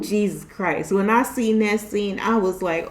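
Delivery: ordinary talk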